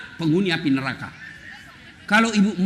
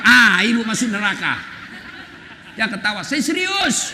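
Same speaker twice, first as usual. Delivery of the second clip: normal talking voice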